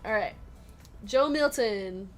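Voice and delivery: ordinary talk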